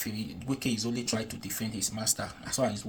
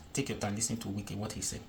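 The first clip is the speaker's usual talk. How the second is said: ordinary talk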